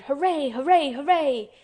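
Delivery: loud, high cheering hooray; shouted hooray. Acted. Boy imagining the garden door, shouting hooray. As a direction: cheer it out at full voice. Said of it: "would probably have shouted".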